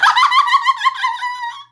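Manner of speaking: a real, high shriek of laughter. Real, not acted. Laughing burst.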